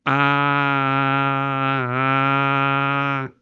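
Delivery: long, held "Ah" squeezed from the throat; throaty sustained ah. Shown as a demonstration. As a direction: hold "Ah" tight in the throat, no breath support. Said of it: "primero con la garganta".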